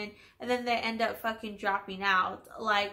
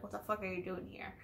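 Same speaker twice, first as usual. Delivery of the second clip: much softer and fast, scolding herself; a quick hushed self-rebuke; muttered to herself; 11 dB quieter, faster than usual. Retold or imitated. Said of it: "'cause I was just like".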